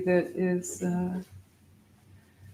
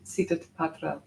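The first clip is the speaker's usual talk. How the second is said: ordinary talk, nothing marked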